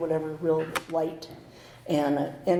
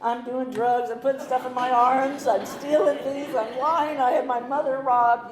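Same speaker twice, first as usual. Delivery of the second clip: higher, louder tumbling confession; higher, louder panicky outpouring; overshared rush; 6 semitones higher, 5 dB louder than usual. Retold or imitated. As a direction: spill it all out in a rush. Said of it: "it all came out like".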